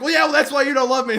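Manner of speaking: fast, loud, high clap-back; quick indignant snap back; snappy comeback. Retold or imitated. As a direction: fire the retort back instantly. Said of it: "he immediately clapped back".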